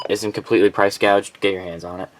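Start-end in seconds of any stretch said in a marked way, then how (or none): none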